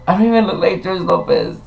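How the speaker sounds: high-pitched feigned indignation; mock protest.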